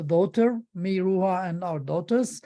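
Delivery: ordinary talk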